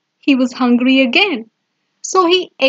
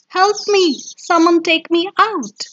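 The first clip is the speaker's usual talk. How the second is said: mild crying yell; 7 semitones higher than usual. A narrator's reading. Stuck mouse calls for someone to free him, mild storybook yell. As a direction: voice a trapped mouse's cry, pitched up without real shouting. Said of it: "Squeaky cried and yelled".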